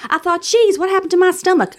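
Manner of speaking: high-pitched mock Southern voice; amateur Southern accent. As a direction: read it as a high, playful Southern belle. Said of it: "I don't know what the Southern accent is".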